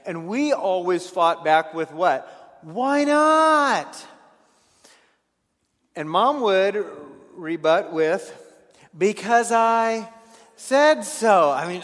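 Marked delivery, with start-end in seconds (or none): 2.6-4.0 put-on high, loud whiny kid's retort; whiny child. Stubborn child whining back at mom, high and loud. 9.0-11.5 loud stern mom, drawn out slowly. Retold or imitated. Mother shutting down her child's question, loud and stern.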